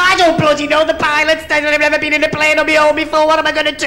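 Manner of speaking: high-pitched frantic pleading; comic panic. Acted. Novice flyer begging controller for help, comic panic.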